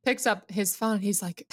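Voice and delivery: usual speaking voice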